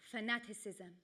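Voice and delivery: soft broken-English grandmother; lightly accented, quiet single word in broken English; grandmother's hushed broken English. Acted. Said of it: "In broken English, she says".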